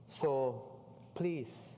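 ordinary talk, nothing marked

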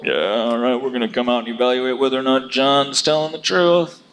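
lightly voiced self-important official; a hint of a swaggering inspector; smug Pharisee. Pharisee sure he has control, lightly mocked swagger.

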